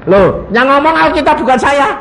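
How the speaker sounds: very high-pitched, challenging question; insistent protest. Real, not acted. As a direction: protest high, then press for an answer. Protesting, pressing congregation to answer. (protes mendesak dengan nada tinggi)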